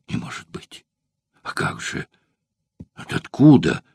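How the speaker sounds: breathy shock rising to a sharp cry; a shaken man learning their secret is out; agitated exclamation. A narrator's reading. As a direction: gasp in disbelief, then cry out. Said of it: "взволнованно воскликнул Спартак".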